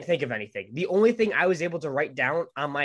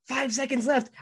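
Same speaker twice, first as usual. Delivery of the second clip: high-pitched, frantic time call; urgent countdown warning; 12 semitones higher than usual. Retold or imitated. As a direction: imitate a panicky last-seconds warning. Acted last-seconds warning at trivia.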